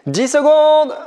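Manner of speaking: high shouted time call. Real, not acted. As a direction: call the countdown out loud. Coach calling out time.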